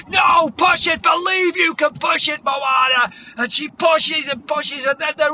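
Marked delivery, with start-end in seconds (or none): 0.1-3.1 loud, high trainer's yell; hammed-up montage coach hollering; shouted urging. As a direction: drive the athlete on at full shout. Trainer urging Moana to push.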